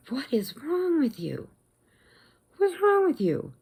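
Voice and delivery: high, exasperated disbelief; retold indignant challenge. Standing up to big rude man, indignant. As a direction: challenge him in rising incredulity.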